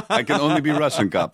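put-on laughing, lightly Russian accent; mock Russian accent. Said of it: "I have one accent".

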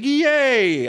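high, slow drawn-out yay; a delighted cheer, stretched out; gleeful yay. Retold or imitated. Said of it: "he's like".

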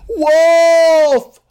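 full-throated shout at the top of his lungs; desperate alarm shout, lungs bursting; yelling wolf. Acted. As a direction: scream it with everything you have. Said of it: "He fucking yells it with all he has".

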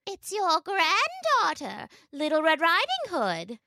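wolf's high, slow imitation of a little girl; a wolf badly putting on a girl's voice; wolf faking a girl. The wolf fooling grandmother, mimicking the girl. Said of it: "mimicking the girl's voice as best he could".

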